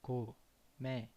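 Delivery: Co-me-di-a said slowly, syllable by syllable; split into syllables. Shown as a demonstration. Teacher breaks word into syllables for learners, slow. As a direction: break Co-me-di-a apart. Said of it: "With acento agudo".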